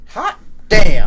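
loud, amazed hot damn; excited exclamation. Real, not acted. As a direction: blurt it out in amazement. Amazed the man knew them.

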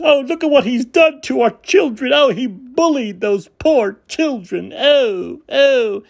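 high mock-whiny wail, slowing down; hammed-up fake outrage; mocking Karen. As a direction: sarcastically whine like an offended Karen. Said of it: "What a tyrant".

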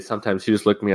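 ordinary talk, nothing marked

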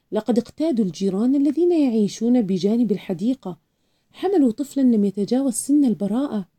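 usual speaking voice

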